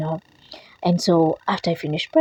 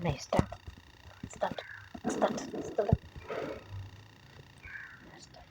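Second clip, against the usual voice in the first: soft coaxing; 3 semitones higher, 17 dB quieter, slower than usual. Real, not acted. Mother coaxing toddler quietly.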